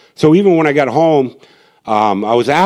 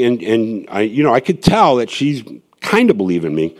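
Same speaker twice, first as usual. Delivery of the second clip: ordinary delivery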